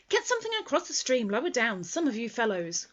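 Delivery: loud, fast, bossy call. Acted. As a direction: hurry the others along. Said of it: "called Rabbit".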